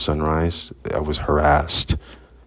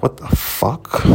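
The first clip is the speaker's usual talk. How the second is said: drawn-out, higher stunned question; retold disbelief; 8 semitones higher, slower than usual. Telling of his shock at finding the key, retold disbelief. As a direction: stretch it out in disbelief.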